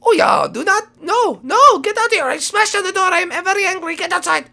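high-pitched mock anger; angry little rabbit. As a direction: scold in a tiny squeaky voice.

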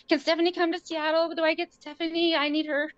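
high pleading voice; mother begging staff, high and pleading. Retold or imitated. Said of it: "I was like".

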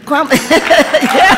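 high, loud laughing. Real, not acted. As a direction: laugh out the name. Laughs at student's name.

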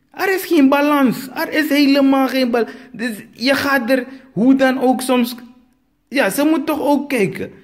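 exasperated high-pitched comic rant; worked-up rant. Acted. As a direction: climb high and hammer it home. Comedian ranting about mismatched couple.

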